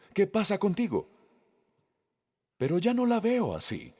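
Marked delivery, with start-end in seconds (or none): 0.1-1.1 imitating a stern rebuke, higher; mock scolding. Jesus scolding Peter for his doubts, mock reproachful. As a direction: play an exasperated teacher scolding, pitched up. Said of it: "Jesús estaba regañando a Pedro".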